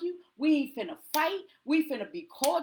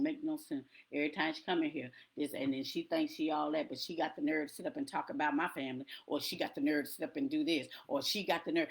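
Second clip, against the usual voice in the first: much lower and softer grumbling; resentful member muttering; 10 semitones lower, 8 dB quieter, faster than usual. Retold or imitated. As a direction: grumble your grudges under your breath.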